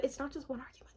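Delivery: hushed whispered aside; a whispered retold line. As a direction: whisper it, almost no voice. Quoting him in an aside.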